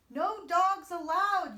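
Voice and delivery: slow, high, very loud yell. A narrator's reading. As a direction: bellow each word at the coyote. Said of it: "yelled Hank".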